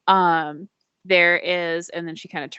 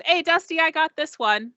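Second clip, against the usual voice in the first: calling out; 10 semitones higher than usual. Acted. Attendant calling to the droid, a high friendly shout. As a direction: shout a friendly hello down the ramp. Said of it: "she kinda shouts and goes".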